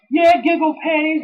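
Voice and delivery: high mock taunt; The Rock impression. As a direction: sneer it in a squeaky voice. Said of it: "he was saying".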